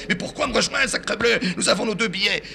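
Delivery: put-on high, exasperated old man; father protesting about the two tickets, high. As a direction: throw up your hands.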